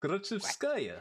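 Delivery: put-on high, chatty gossiping girl; a giggly classmate gossiping; schoolgirl voice.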